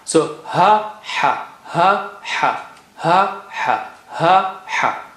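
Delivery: ha said breathy from the middle of the throat against a plain ha; two ha sounds. Shown as a demonstration. Teacher contrasting the two ha letters for learners. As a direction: alternate the two ha. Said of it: "ha is just its head".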